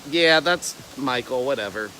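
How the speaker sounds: low, dropping, dismissive shrug; unimpressed. Acted. Friends who know him well react, unimpressed. Voices friends unfazed by his antics.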